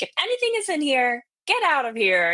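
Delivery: high, loud command; retold yelling. Ordering spirits out of the house, retold yell. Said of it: "yelling around my house like an idiot".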